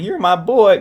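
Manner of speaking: high, loud mock grandma cry. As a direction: blurt it out as an emotional grandma. Repeats grandmother's last words.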